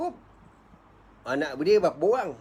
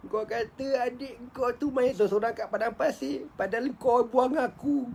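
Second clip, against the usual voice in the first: high, aching voice; grieving lament; 7 semitones higher than usual. Acted. Yusuf silently accusing the brothers who threw him away, lamenting. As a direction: pour out the old wound to the brothers. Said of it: "sedih lah ingat cerita lama ni".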